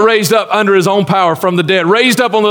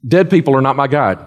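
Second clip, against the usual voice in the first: ordinary delivery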